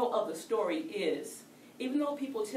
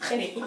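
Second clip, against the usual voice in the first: loud laugh; 6 semitones higher, 12 dB louder than usual. Real, not acted. Laughs recalling the toilet ring.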